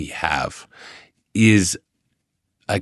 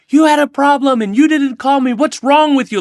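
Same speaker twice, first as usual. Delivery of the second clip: much higher, louder indignant outburst; angry friend; 6 dB louder than usual. Retold or imitated. Russian friend scolding him for not calling, angry. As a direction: hurl it as a wounded reproach. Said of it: "they're really legitimately angry".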